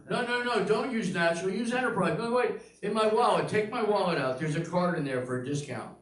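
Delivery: high, loud babbling doctor; doctor distracted, loud and high; doctor chattering. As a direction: rattle on about car rentals mid-surgery. Doctor chatting about rental cars.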